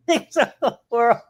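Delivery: high pained laugh through words. Real, not acted. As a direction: laugh while it hurts. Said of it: "Oh, don't make me laugh. It hurts".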